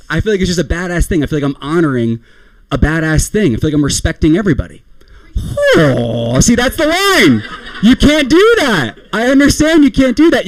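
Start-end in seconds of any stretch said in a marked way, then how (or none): 5.8-10.5 high, drawn-out indignant protest; slow, pitched-up objection; mock outrage. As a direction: cry out in exaggerated indignation. Acts out outraged objection to audience.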